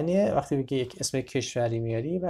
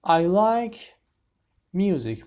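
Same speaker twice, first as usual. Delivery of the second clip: announced sentence; 6 semitones higher, 6 dB louder, much slower than usual. Shown as a demonstration. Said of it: "teacher announces new sentence".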